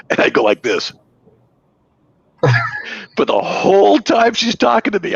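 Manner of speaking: ordinary talk, nothing marked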